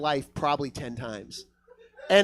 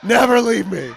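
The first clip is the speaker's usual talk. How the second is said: loud, higher plea; mock devotion; 4 semitones higher, 8 dB louder than usual. Comic to his drunk buddy, loud mock devotion. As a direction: declare it with overblown feeling.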